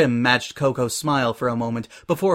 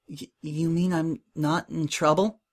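higher, halting, stammered question; shaky; 4 semitones higher, 4 dB quieter than usual. A narrator's reading. Coco asking Prim if she is in trouble, shaky. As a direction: ask shakily, hardly daring to believe it. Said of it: "she asked shakily".

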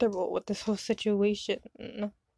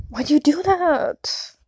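high, loud, slow protest; hurt disbelief; 6 semitones higher, 6 dB louder, slower than usual. Acted. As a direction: drag it out in hurt shock. Reacting to being slapped.

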